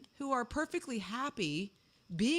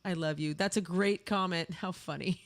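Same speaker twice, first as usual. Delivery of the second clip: ordinary delivery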